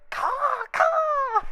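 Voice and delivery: loud, very high mock bird cry; shrieked caw, drawn out and over the top; screeched eagle caw. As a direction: screech like a patriotic eagle, long held. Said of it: "most American-hating American will be like".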